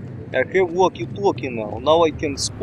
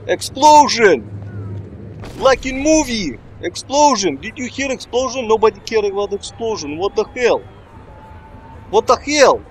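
gleeful explosion hype; 6 semitones higher than usual. Real, not acted. Cheering his own explosion.

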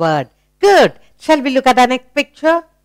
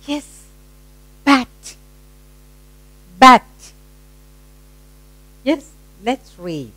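usual speaking voice

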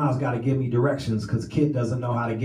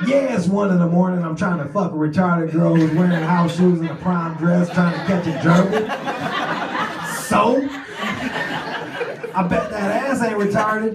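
exasperated retort; 6 semitones higher, 3 dB louder than usual. Retold or imitated. Narrator's exasperated retort to friend.

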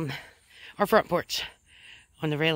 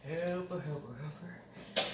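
soft, slow trailing chant; fading singsong; 5 semitones lower, 13 dB quieter, slower than usual. Real, not acted. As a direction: let the chant fade out slowly and softly.

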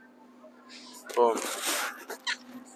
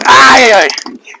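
sudden loud cry of pain; pained yelp; 11 semitones higher, 14 dB louder, much slower than usual. Real, not acted. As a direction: yelp as the shot hits. Getting shot with splat gun.

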